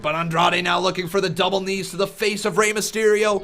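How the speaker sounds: commentary building to a high, loud shout; live play-by-play. Real, not acted. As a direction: call the near-fall as it happens, rising.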